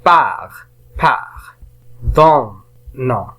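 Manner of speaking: "par", "Don", "Non" each said separately; teacher sounding out "par", "Don", "Non"; syllable by syllable. Shown as a demonstration. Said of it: "la première syllabe".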